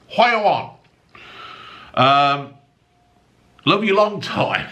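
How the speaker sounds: high, yelled put-on Thai accent; mock Thai accent. Joking about the stroke patient's new accent, in a mock Thai accent. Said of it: "woke up with a Thai accent".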